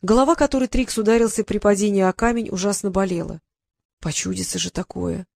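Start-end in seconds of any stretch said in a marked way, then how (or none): 3.7-5.3 quietly wondering aloud; murmured. Acted. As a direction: shake off a strange dream. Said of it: "прошептал Трикс".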